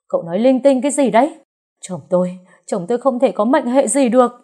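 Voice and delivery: high, loud, fast hysterical cry; frantic wailing. A narrator's reading. Wife refusing the terrible news, hysterical shout. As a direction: scream it in denial. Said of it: "cô gào lên điên loạn".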